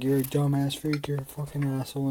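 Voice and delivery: imitating dad's angry yell; dad yelling insults. Dad pulling his son off the couch, mock angry yell.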